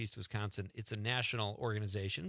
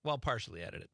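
normal talking voice

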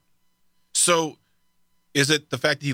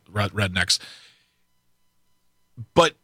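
usual speaking voice

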